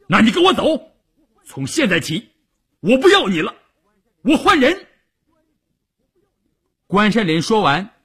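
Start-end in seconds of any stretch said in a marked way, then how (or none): 0.1-4.9 high-pitched angry outburst; furious dismissal. A narrator's reading. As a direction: dismiss them in a rising fury. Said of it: "咬牙切齿的说". (气急败坏地高声赶人)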